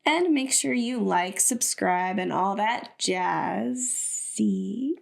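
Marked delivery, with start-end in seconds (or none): 2.2-4.9 very slow, stretched out; drawn-out jazzy. Real, not acted. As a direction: drag out the last words. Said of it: "Jazzy? I see what you did there".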